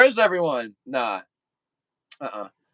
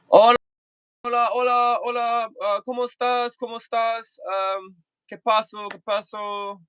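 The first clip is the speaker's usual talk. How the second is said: playful Spanish; 5 semitones higher than usual. Pretend. Mock Spanish greetings, pitched up.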